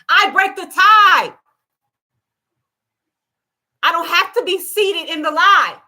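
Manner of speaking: loud, forceful preaching; yelling. Real, not acted. Mother preaching to her audience, loud enough that her child thinks she's yelling. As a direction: declare it at full force, slowing on the tie. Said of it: "Why are you yelling at God?".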